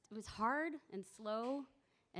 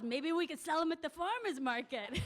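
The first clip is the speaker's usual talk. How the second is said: higher, louder with laughter; old friend's farmer's market dream, laughing; laughing quote; 7 semitones higher, 4 dB louder than usual. Retold or imitated. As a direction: giggle through the idea. Laughing while quoting.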